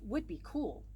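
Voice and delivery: usual speaking voice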